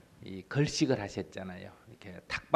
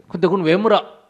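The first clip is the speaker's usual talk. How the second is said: loud, higher, suspicious; irritated shout; 8 semitones higher, 12 dB louder than usual. Retold or imitated. Priest demands why the Buddha asks, irritated. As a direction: demand it sharply.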